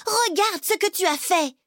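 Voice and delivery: high-pitched indignant reproach; scolding big sister. Acted. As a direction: scold a brother for the damage. Sister scolds brother over damage.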